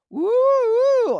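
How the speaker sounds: high, swooping silly "woo"; woo-woo noise. Acted. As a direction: whoop it up and down. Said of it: "they made all that woo, woo, woo noise".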